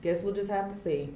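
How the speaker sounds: quiet trailing repeat. Real, not acted. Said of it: "repeat of line".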